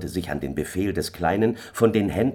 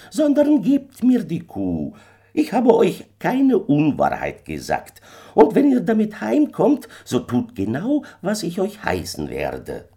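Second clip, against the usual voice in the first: kleiner Fremder; 13 semitones higher, 6 dB louder than usual. A narrator's reading. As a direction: demand it as an uncanny little man. Little stranger demands the cow. (kleiner Fremder, hoch, laut und befehlend)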